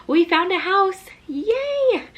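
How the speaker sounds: high, loud happy announcement; squealing yay after a drum roll; excited. Real, not acted. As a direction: burst out with the big news. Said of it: "I am so excited to share".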